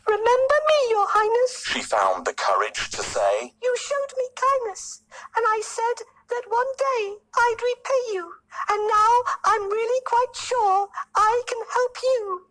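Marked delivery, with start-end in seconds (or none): 0.1-1.6 very high squeaky flattering mouse; tiny pleading mouse. A narrator's reading. Mouse speaks to lion. 3.6-12.5 very high squeaky mouse voice, gaining courage; tiny brave mouse. A narrator's reading. Mouse offers help.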